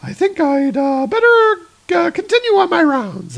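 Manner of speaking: high, hesitant nurse voice; awkward nurse voice. Acted. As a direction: back away, high and flustered. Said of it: "it's like".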